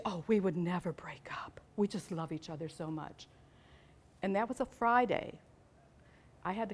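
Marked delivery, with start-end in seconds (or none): 0.0-3.3 put-on girl's whisper. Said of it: "And she".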